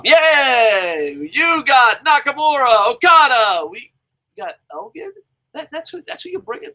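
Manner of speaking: high mock cheer sinking to a disbelieving hush; sarcastic celebration that turns to disbelief. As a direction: fake yay, then incredulous. Mocking his fans' trade reaction.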